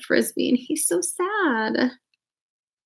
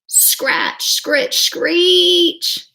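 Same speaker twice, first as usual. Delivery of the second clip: eerie scratching; 7 semitones higher, 3 dB louder, much slower than usual. Acted. Scary noise at window.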